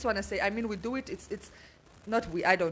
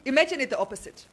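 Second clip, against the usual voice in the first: ordinary talk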